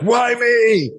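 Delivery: squeaky mock complaint; whiny why me. Shown as a demonstration. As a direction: wail it like a pity party. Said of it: "intonation changes everything".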